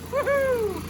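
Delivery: very high thrilled woohoo; excited whoop. Real, not acted. Man cheering his running engine, high gleeful whoop. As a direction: let out a gleeful high cry.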